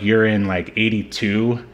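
ordinary talk

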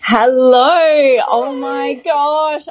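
loud hello, then high, stretched-out gush; thrilled greeting. Real, not acted. Guest just joined to celebrate the host, thrilled greeting. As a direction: burst in delighted, drawing out the words.

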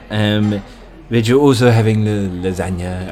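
low-pitched waiter voice. Acted. Waiter taking a diner's order, low waiter voice. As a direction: take the order in a low register.

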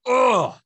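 loud, high "ugh"; exasperated groan. Real, not acted. Venting about a botched headlight job, exasperated groan. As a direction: let out a loud groan of frustration.

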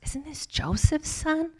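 slow, high, sneering question; scornful doubt. Acted. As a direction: scoff at the hometown boy. Townspeople doubting him.

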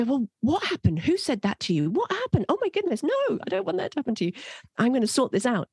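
high-pitched, mock-flustered parental fussing; alarmed parent. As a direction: play a parent leaping in to fix it. Acted parent reacting in alarm.

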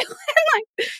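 ordinary talk, nothing marked

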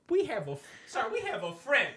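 normal talking voice

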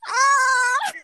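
high, loud startled scream, hammed up; mock scream. Caught off guard by a surprise FaceTime, mock scream. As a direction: shriek as if caught off guard, playfully.

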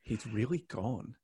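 soft, hushed disbelief; quietly stunned. Retold or imitated. Host recalling Pocock's name tag coming off, soft stunned murmur. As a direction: murmur it as if it only just sank in. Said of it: "I genuinely got emotional".

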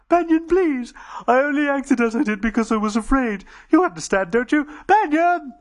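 high, loud pleading alien voice; Dodo begging. Acted. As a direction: beg a friend for mercy. Dodo dragged away.

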